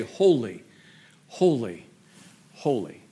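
solemn, spaced-out falling words; lightly voiced angel, a pause after each word; angel's proclamation. Angel proclaiming before God's throne, solemn. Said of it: "hears the voice of that angel say".

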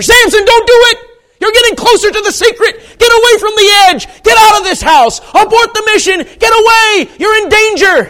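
very high, full-force urgent yelling; screamed warning. Acted. Preacher begging Samson to flee danger, screamed warning. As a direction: shout each command as an emergency.

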